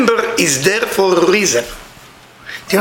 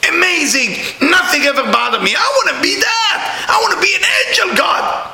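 mock whining to God; 14 semitones higher than usual. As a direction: wail at God like a spoiled child.

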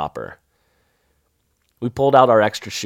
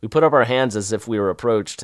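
ordinary delivery, nothing marked

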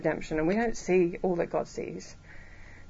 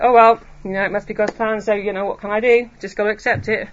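loud, offhand resignation; hammed-up resigned shrug in the voice; mock reluctant acceptance; 8 dB louder than usual. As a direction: imitate someone giving in with an "oh well". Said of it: "we just have to reluctantly accept everything".

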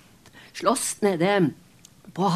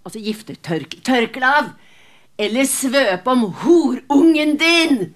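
scornful insult; 4 semitones higher, 6 dB louder than usual. Acted. Grieving mother flings the cloth at Kristin, loud higher scorn. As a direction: spit the insult at her.

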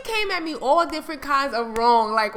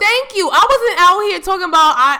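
emphatic loud agreement; 7 semitones higher, 12 dB louder than usual. Real, not acted. Ranting to co-host, agreeing loudly.

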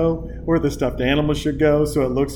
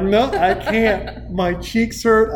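slow and higher, laughing; laughing hard; 6 semitones higher, slower than usual. Retold or imitated. Dad tells wife he can't stop laughing. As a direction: squeeze the words out through laughter. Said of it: "I'm crying".

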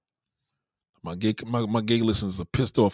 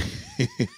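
laugh; 4 dB quieter than usual. Real, not acted. Laughs at own joke.

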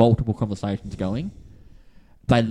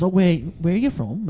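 higher-pitched attempt at a British accent; bad British accent; 7 semitones higher than usual. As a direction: put on a British gent's accent, badly. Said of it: "pretty bad British accent".

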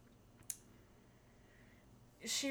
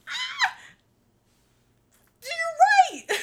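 shrieked, laughing fake agreement, much higher and louder; mock agreement; 13 dB louder, much slower than usual. Mocking the angry friend with a loud, high sarcastic shriek. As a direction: overblown sarcastic "you're right" through laughter.

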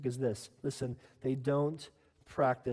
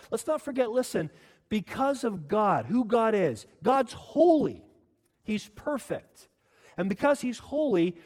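higher, louder, slow emphatic preaching; full-force preaching; 5 semitones higher, 8 dB louder, slower than usual. Acted. Preacher proclaiming God's holiness to the church, fervent. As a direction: bring it home from the pulpit.

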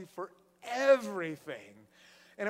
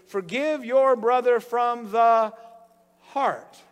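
louder, slow, every word weighted; slow emphatic reading; 10 dB louder, much slower than usual. Acted. Preacher reading the verse to the church, slow and emphatic. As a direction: read the verse out slowly and firmly. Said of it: "the very last line of this is".

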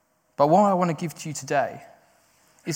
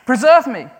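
higher, louder plea; crying out; 8 semitones higher, 7 dB louder than usual. Retold or imitated. David unashamed, crying out to God. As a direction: shout it to God. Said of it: "not ashamed to cry out".

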